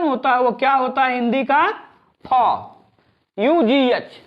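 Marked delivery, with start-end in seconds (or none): 2.3-2.3 फ said alone as a breathy f; teacher sounding out फ. Shown as a demonstration. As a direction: give the sound फ on its own, clearly. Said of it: "उसे हम फ पढ़ेंगे".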